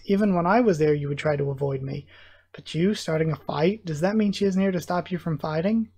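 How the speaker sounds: plain reading voice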